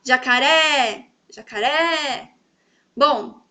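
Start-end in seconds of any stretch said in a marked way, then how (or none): 0.0-2.3 Jacaré, slowly, with a long final RÉ; slow demo of jacaRÉ, last syllable stretched; stress on RÉ. Shown as a demonstration. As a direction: hold out the RÉ in jacaré. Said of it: "se eu falar também eu vou saber".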